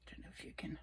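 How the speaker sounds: soft unvoiced aside to viewers; whisper. Real, not acted. Holding a card to the camera, a quick whisper. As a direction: breathe it, barely any voice.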